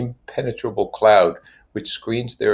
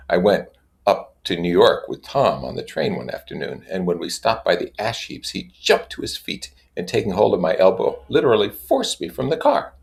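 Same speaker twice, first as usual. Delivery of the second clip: plain reading voice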